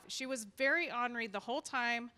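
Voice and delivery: normal talking voice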